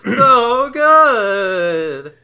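high, loud ecstatic cry. Acted. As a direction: shout it with glee. Joyful exclamation over fries.

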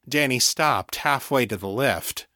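ordinary delivery, nothing marked

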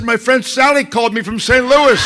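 imitating an excited fan laughing; giggly fan. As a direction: tell it proudly through giggles. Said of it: "She said".

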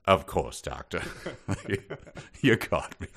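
laughing through a sheepish reply. Retold or imitated. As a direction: caught out, laughing. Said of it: "And he's like".

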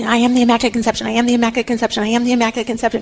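rapid, run-together chant to oneself; rote repetition. Retold or imitated. Bernadette walking to the bishop afraid she'll forget, rapid rote repetition. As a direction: rattle it off over and over, trying to memorise it. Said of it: "She kept saying it as she was walking".

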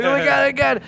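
high, repeated fretting; panicked waiting. Retold or imitated. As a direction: act out hiding in a panic. Re-enacts hiding and waiting in fear.

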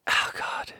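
breathless whispered oh God; whispered embarrassed groan; whispered aside. Real, not acted.

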